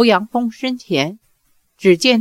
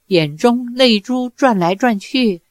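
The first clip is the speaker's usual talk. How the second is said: ordinary delivery, nothing marked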